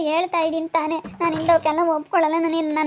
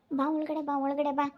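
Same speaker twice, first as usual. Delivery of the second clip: softly urging him inside; hushed call; 9 dB quieter than usual. Acted. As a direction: call him in under your breath. Calling husband in secretly.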